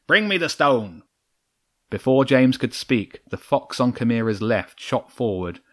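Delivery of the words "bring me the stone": vixen barking an order, higher; shouted command. A narrator's reading. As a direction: demand the stone from her fox. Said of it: "Chimera shouted".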